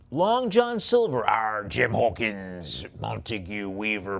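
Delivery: slow, drawn-out West Country pirate growl as Long John Silver; Robert Newton pirate accent. Shown as a demonstration. Auctioneer hamming up Long John Silver's West Country pirate accent. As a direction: ham up the old film pirate accent, Arr-style. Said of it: "the accent of Robert Newton".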